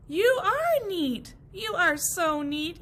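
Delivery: high, loud, slow admiration; admiring praise. Acted. Grasses praising Walt, high admiring. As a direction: praise him with awe.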